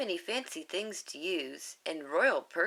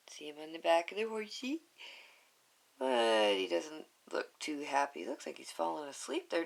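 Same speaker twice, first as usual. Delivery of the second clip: ordinary delivery, nothing marked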